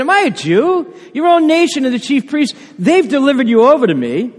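high, loud scornful Pilate; disdain. Acted. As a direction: sneer it down at him like a fed-up governor. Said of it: "You can just sense the disdain he has".

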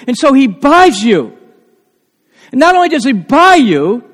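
loud, high fervent preaching; full-voiced revival sermon; preaching at full force. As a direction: preach it with rising passion.

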